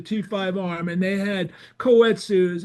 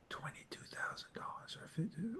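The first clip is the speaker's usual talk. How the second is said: barely voiced, disbelieving whisper; acting out hushed shock; stunned whisper; 23 dB quieter than usual. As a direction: whisper the price back in disbelief. Stunned reaction to the price.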